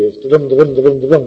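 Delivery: "da-dum" beats chanted evenly, stress on "dum"; galloping iambic "da-dum" chant; da-dum rhythm. Shown as a demonstration. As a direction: tap out "da-dum, da-dum" like a trotting horse. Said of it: "riding along on a horse".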